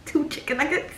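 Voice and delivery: high, soft disbelieving echo; incredulous. Retold or imitated. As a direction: repeat it as a baffled question. Incredulous at girl's answer.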